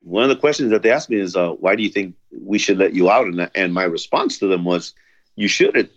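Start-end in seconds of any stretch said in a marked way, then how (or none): none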